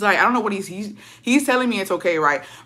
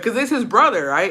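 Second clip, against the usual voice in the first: normal talking voice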